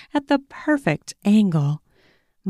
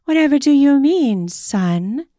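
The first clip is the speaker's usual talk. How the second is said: higher, louder mock innocent; coy innocence; 8 semitones higher, 4 dB louder than usual. A narrator's reading. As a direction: play dumb teasingly. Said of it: "clearly playing along".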